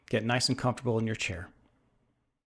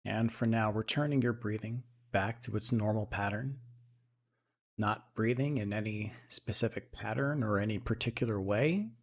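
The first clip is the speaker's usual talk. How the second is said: soft, unhurried meditation voice with long pauses; guided meditation; 2 semitones lower, 5 dB quieter than usual. Acted. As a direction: settle into a quiet, even, calming guide's voice.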